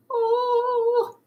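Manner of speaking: ordinary talk, nothing marked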